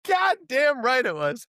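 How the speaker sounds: high, loud cocky comeback; triumphant told-you-so; smug retort. Retold or imitated. Retorting to Soderbergh's admission.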